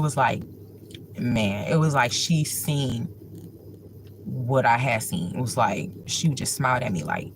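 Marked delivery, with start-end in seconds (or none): none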